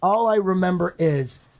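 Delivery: usual speaking voice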